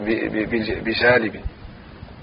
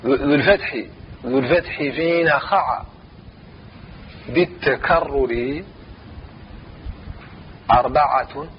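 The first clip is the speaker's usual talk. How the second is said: verse recitation; 4 dB quieter than usual. Shown as a demonstration. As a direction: chant the verse line, drawing out each phrase. Teacher chants the verse line. (تلاوة نظم بتمهل)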